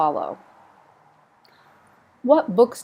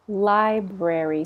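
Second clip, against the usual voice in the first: Library slow, every sound clear; slow careful model; slower than usual. Shown as a demonstration. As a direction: say Library slowly and fully. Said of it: "We don't pronounce that, all the R's".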